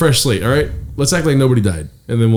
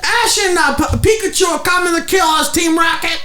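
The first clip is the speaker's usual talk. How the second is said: high cartoon voice. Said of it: "He's like".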